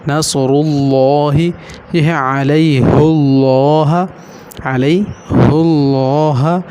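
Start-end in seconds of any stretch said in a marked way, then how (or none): 0.1-1.4 نصر اللہ slow, both r and l thick; heavy r and l. Shown as a demonstration. Teacher demonstrating the rule, heavy r and l in نصر اللہ. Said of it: "دونوں موٹے ہو جائیں گے". 2.4-6.6 علیہ اللہ slow, with a thick "hullo"; heavy l. Shown as a demonstration. Teacher showing pesh before Allah, heavy l in علیہ اللہ. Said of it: "کیونکہ پیش ہے تو پھر موٹا ہو جائے گا".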